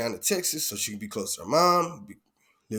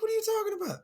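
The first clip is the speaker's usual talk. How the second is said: squeaky falsetto disbelief, way up high; 3 dB quieter, much faster than usual. Retold or imitated. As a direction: push the disbelief even higher.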